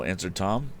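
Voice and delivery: usual speaking voice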